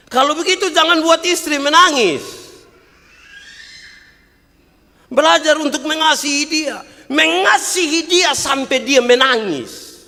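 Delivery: high, loud, driving sermon voice; fiery pulpit delivery; preaching at full force. Acted. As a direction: hammer the point to the mothers.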